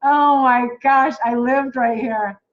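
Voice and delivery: drawn-out real wonder, loud and slow; real excited disbelief. Real, not acted. Finding her old home on the map, amazed and loud.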